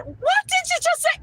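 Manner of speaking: high, loud stunned what did you just say; shocked disbelief. Retold or imitated. Telling about the receptionist, stunned and shrill. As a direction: recoil at a rude question.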